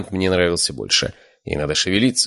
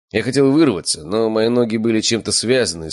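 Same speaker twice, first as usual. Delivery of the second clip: ordinary delivery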